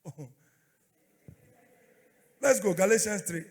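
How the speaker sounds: ordinary talk, nothing marked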